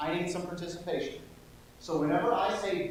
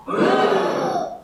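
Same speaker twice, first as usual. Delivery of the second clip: very high, loud boom; thunder boom; 10 dB louder than usual. A narrator's reading. Narrator booming thunder for listening kids. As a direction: crash the thunder out.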